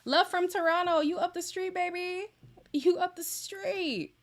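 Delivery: high, loud, happy shout-out; excited. Real, not acted. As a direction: greet a fan with delight. Greeting a viewer from Toronto.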